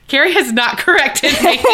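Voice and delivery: high indignant line dissolving into giggles; laughing protest. Retold or imitated. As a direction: crack up while protesting. Repeating protest while cracking up.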